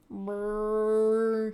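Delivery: teeth-chattering brrr from the cold; shivering brrr. Acted.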